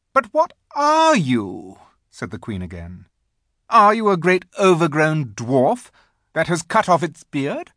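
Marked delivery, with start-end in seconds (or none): 0.1-1.8 high, loud, drawn-out demand; haughty queen's voice, slow and ringing; imperious queen. A narrator's reading. The Queen questions a strange boy, imperious. 3.7-7.7 high, loud, scornful queen; imperious queen. A narrator's reading. The Queen interrogates Edmund, imperious.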